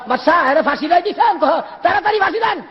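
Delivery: high-pitched insistent cry; urgent plea. Acted. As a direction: clamour to the king for it at once. Acted urgent plea to the king. (উঁচু গলায় ব্যাকুল তাগাদা)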